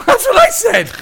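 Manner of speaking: very high, loud squawk after a laugh; yelped, emphatic agreement; incredulous agreement. Real, not acted. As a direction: burst out in amused disbelief. Agreeing loudly with listener's shocked reaction.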